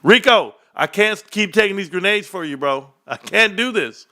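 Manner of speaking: high, loud pleading call; exasperated call-out. Retold or imitated. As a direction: beg your friend to step in. Calling a friend, pleading.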